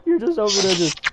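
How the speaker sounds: high, loud laughing talk; can't stop laughing mid-sentence. Real, not acted. Friend laughing at own joke.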